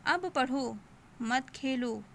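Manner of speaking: अब पढ़ो, a short break, then मत खेलो; pause after पढ़ो. Shown as a demonstration. Teacher orders a pupil to study, pausing after पढ़ो. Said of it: "तो हम वहाँ पर थोड़ा रुकेंगे".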